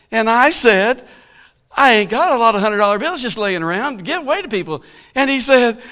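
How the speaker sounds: high, loud preacher's delivery; fervent preaching. Real, not acted. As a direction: preach it out to the whole church. Preaching at full force.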